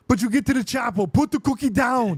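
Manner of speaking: gravelly Austrian action-hero shout; Arnold Schwarzenegger. Acted. Host fires off action-movie lines, loud gravelly Austrian impression. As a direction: bark movie catchphrases with a thick Austrian accent. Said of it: "It's very, uh, gravelly".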